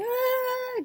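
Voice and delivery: high-pitched drawn-out "aah"; mock crying wail. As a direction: imitate an overwhelmed happy wail.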